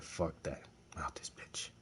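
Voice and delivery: mock-defiant whispered exit. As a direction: breathe it out like a secret vow. Retold leaving home, whispered.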